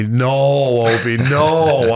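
long, slow, stretched-out no; drawn-out dismay. Retold or imitated. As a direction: imitate a friend's dismay, dragging each no. Friend's dismayed reaction retold.

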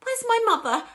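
high, loud distraught yell; bawling. A narrator's reading. Daughter demanding her missing mother, bawling. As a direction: bawl it out in acted distress. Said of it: "bawled Missus Bucket".

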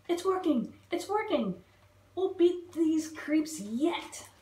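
high triumphant cheering; rally them as it starts to work; excited cheer. Acted. Dilly cheering the bugs on in battle, excited.

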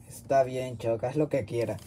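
low, soft, weary consent; tired, muted surrender; resigned. Acted. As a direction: give in quietly. Gives in to his cellmate.

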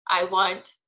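ordinary delivery

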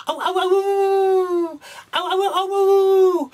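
high, drawn-out wolf howls, loud; howling wolf. Acted. Storyteller to children, howling like a hungry wolf. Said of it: "horrible wolf going".

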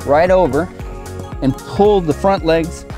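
ordinary talk

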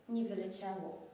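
ordinary delivery

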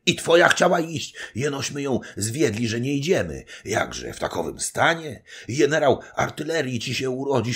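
put-on high, loud, excited old nobleman; hurried, agitated talk. A narrator's reading. Zagłoba reports to Ketling under shellfire, hurried and agitated. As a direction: pour it out urgently, building to alarmed cries. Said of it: "mówił pospiesznie do Ketlinga".

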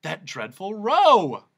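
eager humbug; bright. Acted. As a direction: offer it keenly. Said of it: "volunteered the humbug brightly".

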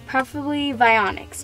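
ordinary talk

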